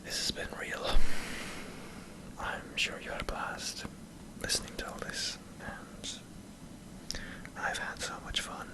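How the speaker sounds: ordinary talk, nothing marked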